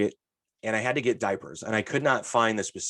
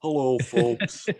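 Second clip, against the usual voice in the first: very slow, drawn-out greeting; slow old clerk; 2 semitones higher, much slower than usual. Retold or imitated. Imitating the slow clerk greeting customers at opening, very drawn out. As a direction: drag the hello out lazily. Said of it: "Just taking his time opening the doors".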